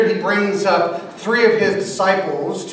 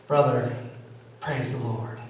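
low, soft solemn blessing; a low, soft blessing to the stranger; mock solemn; 6 semitones lower, 8 dB quieter than usual. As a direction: say it gravely and quietly. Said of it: "I had to say to him".

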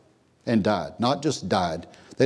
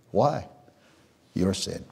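ordinary talk, nothing marked

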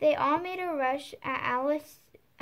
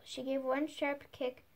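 plain reading voice

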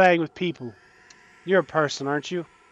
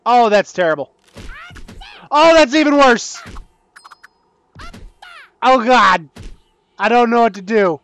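loud, high cries of dismay; panicking; 6 semitones higher, 6 dB louder than usual. Real, not acted. Gamer watching the tower get worse, panicking. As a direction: cry out helplessly, losing control. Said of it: "Oh my God, I'm panicking".

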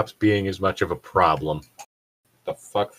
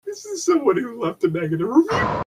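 high amused realization; 13 semitones higher than usual. Real, not acted.

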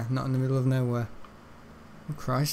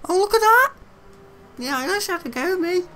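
much higher squeaky cartoon voice; squeaky silly voice; 4 dB louder than usual. Streamer voicing a game figure, in a squeaky voice.

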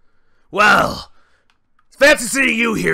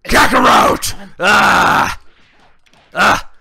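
louder raging outbursts; berserk yells; 2 semitones higher, 6 dB louder, much slower than usual. Acted. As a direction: scream like a Saiyan losing control. Broly rampaging.

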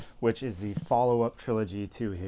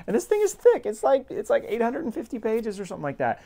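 high, loud, incredulous burst about the size; sudden squeal of disbelief, much higher and louder; exasperated outburst; 10 semitones higher, 4 dB louder than usual. Real, not acted. Vlogger exclaiming to viewers how thick the book is, high incredulous squeal.

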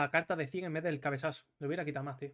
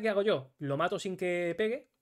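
ordinary delivery

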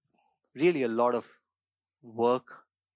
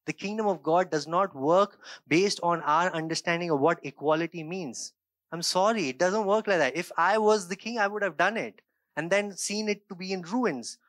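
preaching at full force, raised and driving; fervent preaching; 5 semitones higher, 4 dB louder than usual. Acted. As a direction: press the message home to the congregation.

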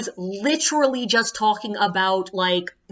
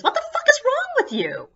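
much higher incredulous shriek; appalled high-pitched outrage; shocked outburst. Retold or imitated. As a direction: shriek it in disbelief. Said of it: "And I'm like".

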